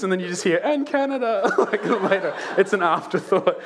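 high, breathless laughter through the words; laughing hard. Real, not acted. Pastor teasing his Canadian friend, laughing high. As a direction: laugh while teasing "for Greece".

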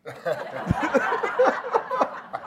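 shrieking laugh, high and helpless; high burst of laughter. Real, not acted. Laughing after mum's last words.

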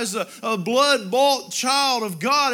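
ordinary talk

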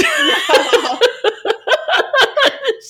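big high burst of laughter; long high real laughter. Real, not acted. Laughing at own story.

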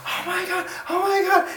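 put-on excited children. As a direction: squeal like thrilled little kids. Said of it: "You're like".

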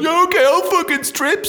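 high, eager mock agreement; very high mock eagerness; giving in gleefully. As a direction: cave in with reckless glee. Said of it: "taking the encouragement".

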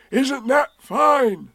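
high, slow, loud call; drawn-out high shout. A narrator's reading. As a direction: call it down from far above. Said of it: "shouted Winnie the Pooh down to you".

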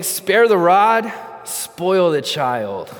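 slow, stretched-out declaration, loud and high at first; loud proclamation. Retold or imitated. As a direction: proclaim it over the whole room, drawing out each phrase. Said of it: "proclaimed this statement loudly over the room".

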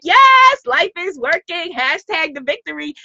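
mock-triumphant cheer, starting high; exuberant celebration. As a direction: act out bursting in triumphant. Acting out arriving happy and victorious.

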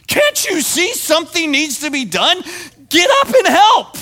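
mock inner scream at Mary. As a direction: yell it in frustration. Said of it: "inside me is screaming".

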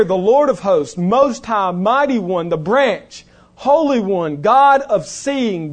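loud, rising litany of names for God; preaching at full force. Acted. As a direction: build each title like a fiery preacher. Preacher lists God's names.

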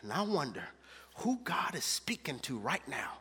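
hushed, intimate prayer voice. Acted. Pastor praying quietly over the congregation, hushed. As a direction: pray softly over the people.

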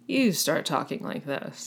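slow, soft mock narrator voice; imitating narrator voice. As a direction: do a hushed parody of the narrator. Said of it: "when you go into your narrator voice".

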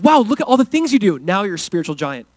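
exaggerated, impressed exclamation; mock amazement. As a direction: gush as if hugely impressed. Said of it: "God's not like".

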